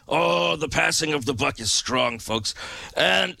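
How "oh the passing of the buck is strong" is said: ordinary talk, nothing marked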